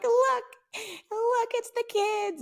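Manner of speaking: very high, slow squeal; excited mother. Retold or imitated. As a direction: imitate Mom squealing to Dad. Mother excitedly showing dad the kids.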